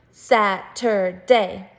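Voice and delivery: Saturday in three slow, separated chunks; syllable by syllable. Shown as a demonstration. Teacher counting syllables with first graders, slow and chunked. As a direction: break Saturday into SAT, UR, DAY, slowly. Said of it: "Do it with me".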